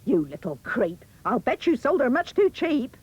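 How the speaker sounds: lightly voiced suspicious mother, jumping up in pitch; scolding mother. A narrator's reading. Mother accusing Jack over the cow, scolding voice. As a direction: scold the boy, rising on the accusation. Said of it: "The mother said".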